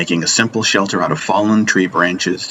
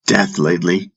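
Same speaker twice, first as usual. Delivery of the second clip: plain reading voice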